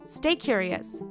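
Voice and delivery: ordinary delivery, nothing marked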